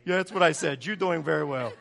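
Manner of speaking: lightly imitated Puerto Rican accent, laughing; mock Puerto Rican lady, loud and amused. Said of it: "She's from Puerto Rico".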